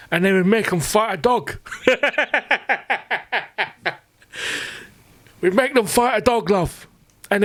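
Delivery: put-on high, frail old war veteran reminiscing; old soldier voice. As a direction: play a doddering veteran boasting to love.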